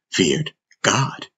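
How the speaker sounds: ordinary delivery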